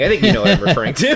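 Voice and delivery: laughing while talking. Real, not acted. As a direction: giggle through the words. Laughing at own pantomime.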